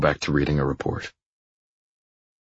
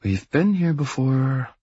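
high, slow, sing-song tease; girlfriend's drawn-out, high-pitched teasing; 9 semitones higher, slower than usual. A narrator's reading. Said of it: "in that teasing tone she has".

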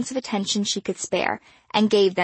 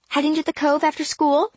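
lightly voiced teen girl; 8 semitones higher, 3 dB louder than usual. A narrator's reading. Star's voice in dialogue.

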